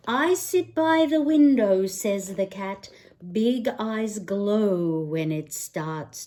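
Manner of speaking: lively picture-book read-aloud; singsong bedtime book voice; reading to children. Acted. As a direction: read it like storytime for a little one.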